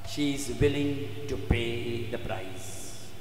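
low, soft and slow; weighty, subdued statement; hushed, solemn. Shown as a demonstration. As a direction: let it sink down slowly and quietly. Solemn statement, then repeated with force.

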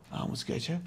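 whispered gossip about a visitor; a nosy neighbour's whisper. Retold or imitated. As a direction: hush it like backbiting.

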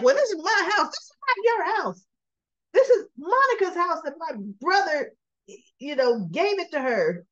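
high-pitched, emphatic protest; playfully imitated outraged retort; mock indignant. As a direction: stake your claim in a hammed-up quarrel. Olivia argues over the house, retold.

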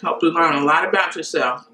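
chewing while speaking; talking between bites; mouth full. Real, not acted. Seen 1: Ate a bite at -9; cheeks and lips show chewing while talking.

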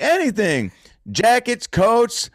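high, emphatic Houston twang, falling on "Anything"; thick Houston accent. Retold or imitated. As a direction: confirm it as the Houston woman, in her accent. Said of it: "And she's like".

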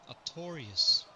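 "Artorius" as ar-TOR-ee-us, loud and firm; correct pronunciation. Shown as a demonstration. Streamer demonstrates a name, "Artorius" pronounced ar-TOR-ee-us. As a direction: hit TOR in "Artorius", falling to the end. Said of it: "That's the correct pronunciation".

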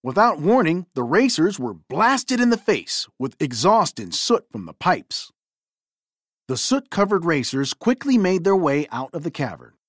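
ordinary delivery, nothing marked

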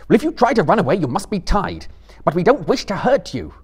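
loud, high, insistent reasoning. A narrator's reading. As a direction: argue firmly over someone's screams.

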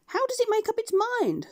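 very high, fussy duck voice. Acted. Duck wondering about the two heads, high fussy voice. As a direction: cluck it like a scandalised duck. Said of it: "said the duck".

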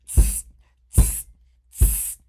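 loud sharp tss; cheeky monkeys tsk back. Acted. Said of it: "and said".

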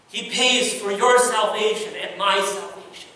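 ordinary delivery

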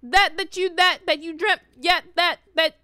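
iconic stutter. Retold or imitated. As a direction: stumble excitedly over repeated words. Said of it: "the stutter is iconic".

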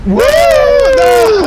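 excited scream. Real, not acted. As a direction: whoop turning into a very loud, high scream. Blows himself up in game.

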